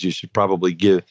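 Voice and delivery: ordinary delivery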